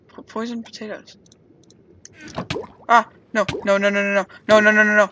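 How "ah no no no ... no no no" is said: loud, frantic rising no's; panicked. Real, not acted. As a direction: panic as it all goes wrong. About to die.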